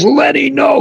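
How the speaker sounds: sharp high yell of alarm. Retold or imitated. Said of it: "Charlie yells".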